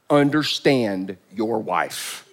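"understand" drawn out slow and heavy; slow stressed command. Shown as a demonstration. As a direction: lay weight on "understand", slowly. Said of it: "Let me rephrase that so we can all understand it".

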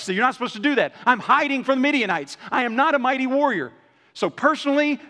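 high, loud protesting complaint; frantic objecting Gideon. Acted. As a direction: plead that you're nobody. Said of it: "But he's like".

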